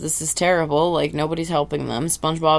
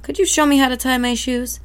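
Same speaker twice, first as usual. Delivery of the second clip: higher, innocent asking; pleading kid; 8 semitones higher than usual. Acted. Sponge politely begging a stranger for shoe help. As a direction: plead like a hopeful little kid. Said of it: "asks an eel".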